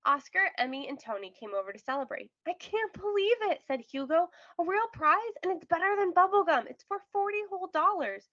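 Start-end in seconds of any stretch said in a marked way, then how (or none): 2.5-8.3 much higher, louder, delighted disbelief; thrilled he finally won; overjoyed. Acted. Hugo celebrates his first prize with friends, overjoyed.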